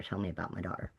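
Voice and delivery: quiet insistence. Retold or imitated. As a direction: say it again under the breath, insisting.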